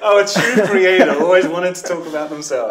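loud, high, through laughter; laughing hard as you speak; laughing tease. Real, not acted. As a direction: tease loudly while cracking up. Teasing host amid shared laughter.

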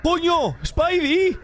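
shocked high exclamations; startled shout. Real, not acted. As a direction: blurt it out as she flies up. Woman crawls on ceiling.

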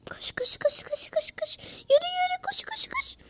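high, breathless whispered run run run; urgent whisper. Real, not acted. Gamer urging his brawler to run, whispering. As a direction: hiss it urgently under the breath.

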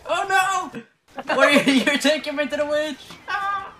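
high, frantic panicked cries; panicked shrieking. Real, not acted. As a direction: panic while dragged toward the witch. Running from the witch, shrieking.

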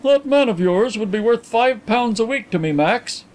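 high-voiced, mildly thoughtful gentleman. A narrator's reading. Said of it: "he remarked thoughtfully".

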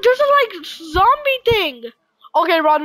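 ordinary talk

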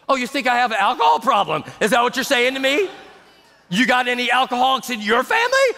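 high, quick, escalating accusations; mock outrage. Drinker suspects an insult about alcohol, mock outrage.

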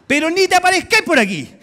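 high, loud mock dismissal; comic scolding. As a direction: send him packing, loudly and playfully. Acts Jesus sending Tomás away. (regaño fingido en voz alta)

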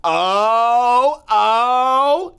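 long, loud rising "oh"; high drawn-out mock gasp of joy; imitated delighted recognition. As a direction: imitate someone lighting up on seeing a friend. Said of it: "I'll never forget the expression".

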